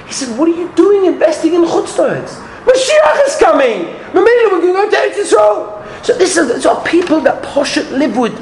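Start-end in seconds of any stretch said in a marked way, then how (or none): 0.4-6.0 much higher, exclaiming in disbelief; can't believe anyone would invest; incredulous rebbe. Retold or imitated. Rebbe to visitor buying abroad, incredulous exclaiming.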